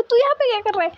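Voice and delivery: high-pitched, startled question thrown back; surprised comeback. Retold or imitated. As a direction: act out startled recognition of a friend. (हैरानी से, ऊँची आवाज़ में पलटकर सवाल)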